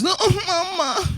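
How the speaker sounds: put-on high, stretched-out kid's wail; mock child's alarm in a high squeal; imitating scared child. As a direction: mimic a frightened little boy crying out. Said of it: "I heard him make a noise".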